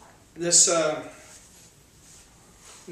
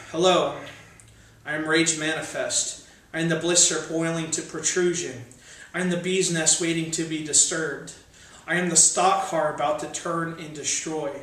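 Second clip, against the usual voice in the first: louder menacing poem voice with a falling hello; ominous spoken-word, a touch raised; 2 semitones higher, 4 dB louder than usual. Acted. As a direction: announce yourself as rage itself.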